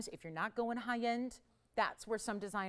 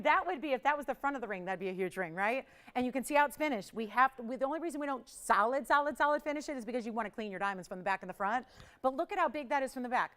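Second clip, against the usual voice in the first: normal talking voice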